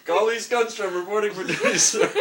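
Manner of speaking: loud laughing military report; crisp loud soldier report. Pretend. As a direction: report for duty like a private. Mock soldier reporting for duty.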